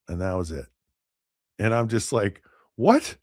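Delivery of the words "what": high-pitched acted astonishment; one sharply higher, incredulous question; stunned disbelief. Driver stunned by the light penalty.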